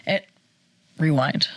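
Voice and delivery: ordinary talk, nothing marked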